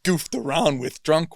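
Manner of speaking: real laughter breaking up the words; laughing through it. Real, not acted. Laughing at own drunk toy memory.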